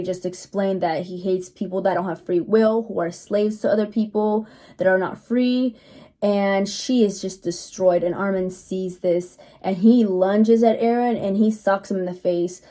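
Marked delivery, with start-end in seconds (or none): none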